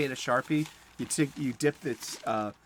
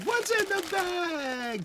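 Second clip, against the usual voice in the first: much higher, slow, drawn-out "What's in the bag?"; sing-song game show call; much slower than usual. Acted. Host teasing viewers before opening the bag, high sing-song.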